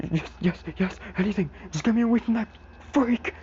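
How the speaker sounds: high, frantic pleading; All For One begging. Retold or imitated. Beaten villain begs to get away, panicked plea.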